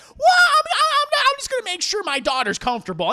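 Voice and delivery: high, loud, stammering excuse; flustered defensiveness. Acted. Leanne excuses checking on her daughter, high and flustered. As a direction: blurt it out, tripping over the start.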